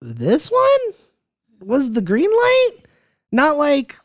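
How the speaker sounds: high, drawn-out incredulous question; frustrated disbelief. Acted. Griping about the second film they green light, in frustrated disbelief. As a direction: question it in a high pitch, dragging the words. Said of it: "it's so frustrating".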